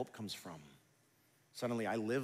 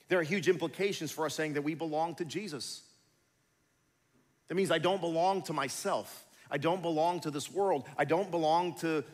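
loud, high, driving sermon voice; full-force preaching; 6 semitones higher, 7 dB louder than usual. Acted. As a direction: preach it hard to the whole congregation.

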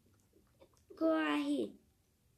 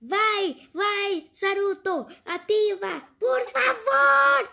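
louder, higher, urgent begging; urgent pleading; 3 semitones higher, 7 dB louder, much slower than usual. Pretend. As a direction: beg him to go for it. Urging brother to activate.

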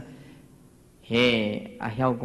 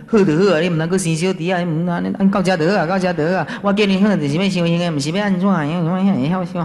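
teasing retold admonition; 4 semitones higher, 6 dB louder, faster than usual. Retells teasing advice to reconciled couple.

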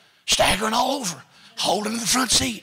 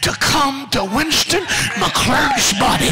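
a louder and louder shouted healing prayer, ending much higher; preaching at full force; 4 semitones higher, 6 dB louder, slower than usual. Acted. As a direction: command the sickness out, building to a shout. Prays commands for healing.

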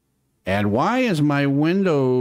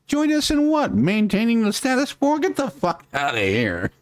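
much higher, scoffing sarcastic questions; sarcastic disbelief; 10 semitones higher than usual. Real, not acted. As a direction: toss the question back in mocking disbelief. Scoffing retort to quoted liberal.

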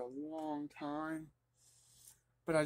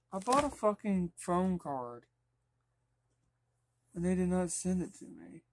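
angry; 4 semitones higher, 4 dB louder than usual. Real, not acted. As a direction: spell out the anger word by word. Angry, missing card.

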